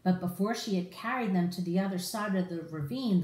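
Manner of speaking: usual speaking voice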